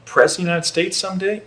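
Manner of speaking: put-on pinch-yourself wonder in a Southern accent; mock disbelief. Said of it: "I say".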